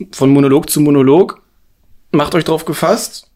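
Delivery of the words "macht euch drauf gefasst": raised, commanding classroom voice; strict teacher. Pretend. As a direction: bark orders at a class. Mock teacher addressing listeners.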